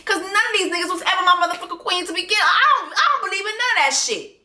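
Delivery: ordinary delivery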